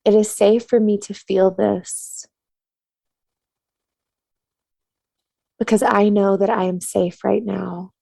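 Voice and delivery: soothing meditation-teacher cadence; guided tapping meditation. Acted. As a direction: calmly guide them to tap and breathe.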